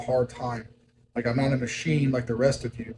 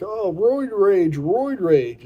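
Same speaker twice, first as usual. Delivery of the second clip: slow, drawn-out mock exclaim, higher and louder; mocking gossip; 8 semitones higher, 6 dB louder, much slower than usual. Dieter mimicking people blaming steroids, drawn-out mock. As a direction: parrot what people say, scoffing. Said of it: "people talk about steroids".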